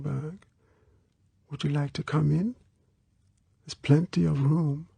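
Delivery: put-on high, soft girl's voice; small voice. A narrator's reading. Girl inviting him into the sleeping bag, small voice. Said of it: "The small voice said".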